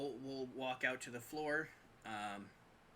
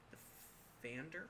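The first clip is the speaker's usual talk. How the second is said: unsure mumble; 10 dB quieter, much slower than usual. Real, not acted. Fumbling a word.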